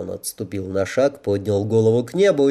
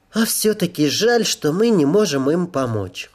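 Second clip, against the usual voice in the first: put-on high-pitched girl's voice with gentle regret; girl angel, regretful; 8 semitones higher than usual. A narrator's reading. Angel regrets they cannot help, high girlish voice. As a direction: voice a wistful young angel, lifted high.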